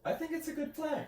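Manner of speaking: high squeaky mock innocence; sarcastic. Real, not acted. Teasing her friend, playfully sarcastic. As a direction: insist on it with fake sincerity. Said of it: "Your sarcasm".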